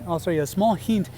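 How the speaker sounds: usual speaking voice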